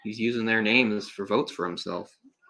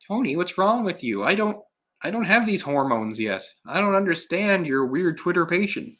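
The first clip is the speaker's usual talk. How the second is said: voicing the girl higher, teasing her naivety; a lightly higher, mocking girlish voice; mock girl's voice; 7 semitones higher than usual. Mocking the girl from the movie, higher pitched.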